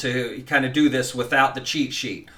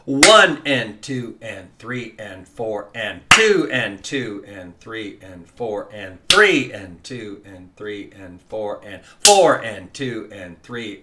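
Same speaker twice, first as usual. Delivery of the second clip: slow count, each "one" of the measure punched; accent the one; 4 semitones lower, slower than usual. Shown as a demonstration. Teacher counting slowly and accenting the first beat. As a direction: hit the first beat, keep the rest even. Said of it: "So it's gonna sound like this".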